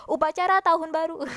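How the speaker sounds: loud, high recitation on every word. Shown as a demonstration. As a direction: belt out the whole line high. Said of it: "kamu bacanya pakai ditinggi suara".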